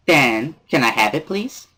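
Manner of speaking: put-on high, bright-spirited girl pressing hard; sing-song scolding of the class rep; cheerful Misha. Misha scolding Lily about the late budget, high and chirpy.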